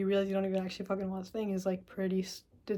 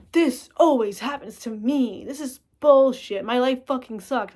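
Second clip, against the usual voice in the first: higher, louder, slow whiny rant; self-pitying complaint; 4 semitones higher, 8 dB louder, slower than usual. Acted. The self-pitying driver he won't be, whining loudly. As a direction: wallow like the world is against you. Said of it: "not gonna be like".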